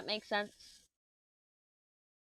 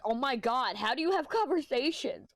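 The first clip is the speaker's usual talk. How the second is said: raised, higher-pitched mock exasperation; incredulous complaint, building higher; exasperated friend; 7 semitones higher, 4 dB louder than usual. Friend fed up with her topic-jumping, acted out exasperated.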